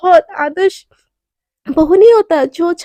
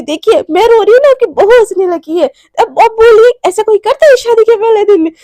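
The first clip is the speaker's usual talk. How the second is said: mock outrage; 5 semitones higher, 6 dB louder than usual. Naina complains her bride laughs.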